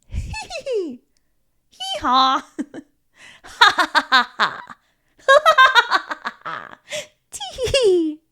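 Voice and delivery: high, loud put-on giggles turning into real laughter; silly forced giggling that gets genuinely funny; fake laughs. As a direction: ham up the fake laughs until they catch. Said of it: "Try some fake laughs".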